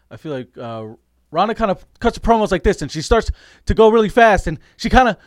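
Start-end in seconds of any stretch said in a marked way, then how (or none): 1.3-5.2 fast, rising, louder stuttering promo voice; Ronda Rousey impression. Cohost imitating Ronda's rushed promo, fast and louder. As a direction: rush and stutter like a winded fighter. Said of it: "That was actually a really good Ronda Rousey".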